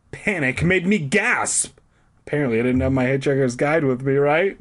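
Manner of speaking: ordinary delivery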